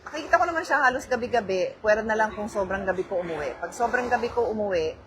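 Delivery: ordinary talk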